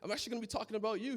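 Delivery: excited reply. Retold or imitated. Son answering his dad on the phone, eager and excited. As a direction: gush back with eager joy. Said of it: "I said".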